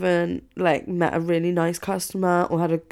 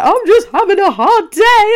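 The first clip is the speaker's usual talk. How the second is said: much higher, louder, slow wail; mock upset friend; 12 dB louder than usual. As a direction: whine it like a friend on a hard day.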